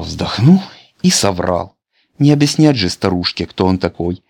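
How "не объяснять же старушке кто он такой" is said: plain reading voice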